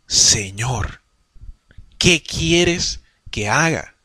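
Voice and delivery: low, soft, very slow surrender; a broken man asking what to do, slowly; humbled Saul. Acted. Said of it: "cambió y dijo". (Pablo rendido, bajo, suave y muy lento)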